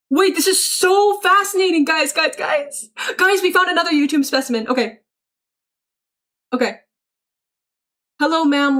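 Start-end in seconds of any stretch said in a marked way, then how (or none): 0.1-4.7 higher, excited calling of everyone over; gushing like a specimen has been found; mock excited discovery. Streamer announces a new YouTube specimen to chat, mock excited.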